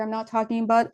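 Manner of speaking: ordinary delivery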